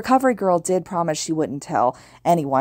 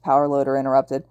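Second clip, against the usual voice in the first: plain reading voice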